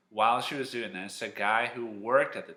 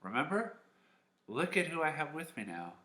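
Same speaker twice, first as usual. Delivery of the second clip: higher, lightly voiced eager Hannah; eager proud mother; 7 semitones higher than usual. Hannah showing her son to Eli, mildly eager. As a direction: lift "Remember?" into a rising prompt. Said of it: "she's like".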